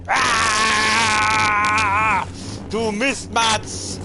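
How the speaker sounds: loud, drawn-out insult at enemy; angry shout. Real, not acted. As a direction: yell it at the enemy. Yells at enemy in game. (wütender Schrei)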